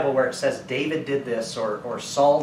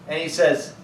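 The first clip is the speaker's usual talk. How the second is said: normal talking voice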